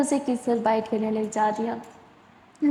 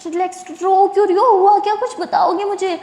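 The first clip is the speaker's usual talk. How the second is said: higher, louder urgent "relax"; worried girlfriend urging her to relax; anxious reassurance; 7 semitones higher, 4 dB louder than usual. Acted. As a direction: rush to calm her. Jaz alarmed at Sara crying.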